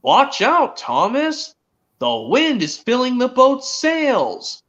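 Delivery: high-pitched character warning, drawn out; Molly. Retold or imitated. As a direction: call out a slow, high warning like a cartoon engine. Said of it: "Molly spoke in Thomas Set Sail".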